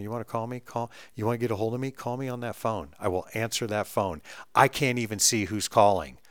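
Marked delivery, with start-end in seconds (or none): 0.0-4.2 much softer, pointed call me; soft, firm telling-off; quiet insistence. Retold or imitated. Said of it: "I'm like".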